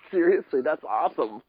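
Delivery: high laugh into the word. Real, not acted. Laughing at carded story.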